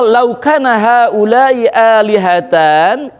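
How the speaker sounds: slow chanted Arabic verse; quranic recitation. Acted. Preacher recites a verse to the congregation, slow chant. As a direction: recite the verse slowly and drawn out.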